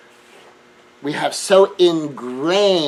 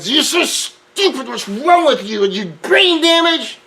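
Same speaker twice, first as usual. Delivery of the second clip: high, loud mock insult; hammed-up angry put-down; cruel yelling; 8 semitones higher, 4 dB louder than usual. Shown as a demonstration. Someone lashing out at a loved one, high and loud. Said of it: "Instead of going".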